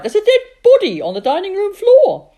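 high, loud breathless report; crying out in alarm. Acted. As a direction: rush the news out, alarmed. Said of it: "she cried".

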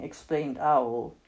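usual speaking voice

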